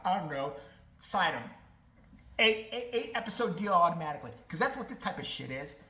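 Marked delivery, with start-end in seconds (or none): none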